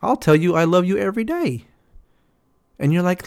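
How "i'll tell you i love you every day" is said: ordinary talk, nothing marked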